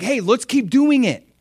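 high, loud, eager encouragement; happy urging. Acted. God urging his people on, happy and excited. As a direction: urge them on excitedly. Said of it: "the happy heart of God getting happy".

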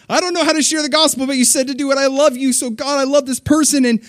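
high, rushed, earnest plea to God; nervous eager prayer. Retold or imitated. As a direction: pray like a nervous new believer stepping out. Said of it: "I might go like".

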